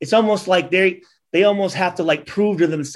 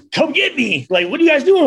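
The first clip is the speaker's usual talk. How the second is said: higher, playful come-on; 8 semitones higher than usual. Retold or imitated. Girls at the club calling the players over, high playful voice. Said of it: "girls are like".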